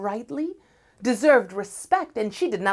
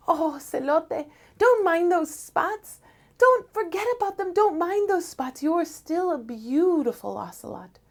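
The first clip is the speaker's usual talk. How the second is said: comforting sister; 9 semitones higher than usual. Acted. Venus comforts brother.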